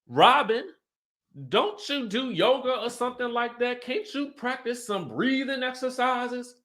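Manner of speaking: high teasing voice of a castmate; imitating Tanika. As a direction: needle her with a sweet high voice. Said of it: "So then Tanika's like".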